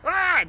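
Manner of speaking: high mock scream; panicked scream. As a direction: cry out in alarm. Passengers panic, train stops.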